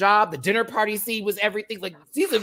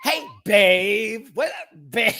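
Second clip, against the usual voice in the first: imitated TV character; 5 semitones higher, 5 dB louder, slower than usual. Voicing character from the episode.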